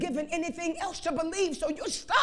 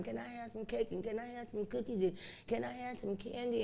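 put-on soft wheedling little child's voice, much softer; begging child; 2 semitones lower, 11 dB quieter than usual. Preacher voicing a begging child asking for sweets. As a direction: beg sweetly like a small child. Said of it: "my mama used to call it a begging Jenny".

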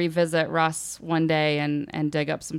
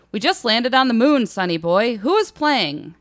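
mildly bellowed, higher-pitched quote; bellowing; 5 semitones higher, 5 dB louder than usual. A narrator's reading. Club owner phoning his teenage helper, bellowing. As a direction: call it out like an excited boss, moderately loud. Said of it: "Gabe Glantz bellowed".

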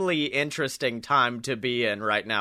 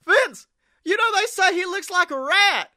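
much higher, louder put-on voice; Arn impression; 6 dB louder than usual. Arn pitching the rat idea to Vince, high put-on voice. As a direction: squeeze it up high and loud like an old wrestler. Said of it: "That means I get to do another impression".

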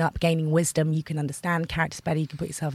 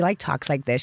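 lightly imitated American mother; a hint of American twang; American accent; 3 semitones lower than usual. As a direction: slip into a casual American accent. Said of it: "My mother's American".

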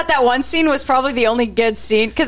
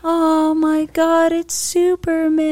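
slow, higher, drawn-out amazement; mock awe; 4 semitones higher, much slower than usual. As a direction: stretch every word like a stunned fan. Acting out amazed recognition.

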